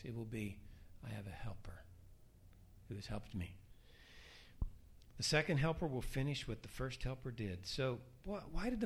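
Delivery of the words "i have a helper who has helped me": soft, low, nearly whispered; humble confession. Acted. Believer answering before Christ, hushed humble confession. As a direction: say it quietly and humbly.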